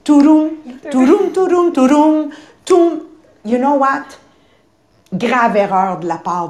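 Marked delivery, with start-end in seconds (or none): 0.0-3.0 higher, louder sung nonsense hum; carefree humming inside the head; mind wandering hum. Retold or imitated. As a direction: hum a tune, ignoring the mentor. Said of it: "dans ton cerveau, ça fait tout".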